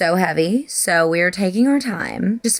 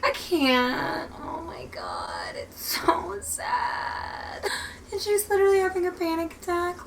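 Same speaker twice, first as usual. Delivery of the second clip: high, thin voice breaking into whispers; tearful, overwhelmed; 6 semitones higher, 5 dB quieter than usual. Real, not acted. Reader upset by a child's panic attack, crying. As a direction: squeak through tears, trailing into a soft whine.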